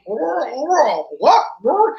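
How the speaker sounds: high, goofy mock squawk; swooping, cartoonish high-pitched cry; goofy character imitation. Imitating Dave's goofy character on set.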